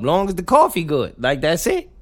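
ordinary talk, nothing marked

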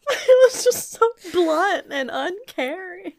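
high, slow, squeezed out through laughter; real giggling fit, voice lifted high; helpless laughter. Real, not acted. Co-host explaining why it was funny, laughing hard.

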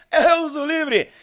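high, loud cry of disbelief; loud exclamation. Real, not acted. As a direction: blurt it out in shock. Exclaiming in shock.

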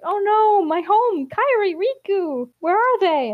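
high, loud panicked cries; freaking out. Retold or imitated. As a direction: panic about home and friends. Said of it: "he starts freaking out".

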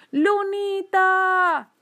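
high, very drawn-out singsong call. A narrator's reading. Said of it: "volvió a llamarla".

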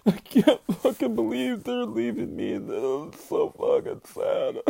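high, wailing fake sob; hammed-up baby blubbering; mock blubbering. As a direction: ham up crying like a baby over a show ending. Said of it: "blubbering like a goddamn baby".

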